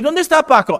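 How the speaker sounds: high, quick puzzled question; hammed-up curious inquiry; asking after someone. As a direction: call out looking for someone, pitched well up. Imagined heaven dialogue, asking around.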